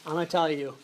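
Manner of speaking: usual speaking voice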